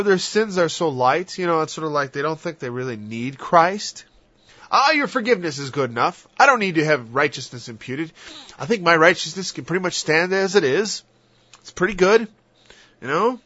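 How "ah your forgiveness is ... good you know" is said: higher, smug, self-satisfied; mock self-righteous. Mocking a self-satisfied believer, smug. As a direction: puff up with self-regard.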